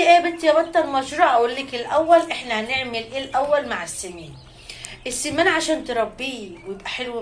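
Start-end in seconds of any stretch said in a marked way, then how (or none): 0.0-1.3 fast, higher and louder question as a viewer; viewer's question. Acted. Viewer asks Batta about the project, questioning. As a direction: quickly put on a curious viewer's questioning. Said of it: "هتقولي لي".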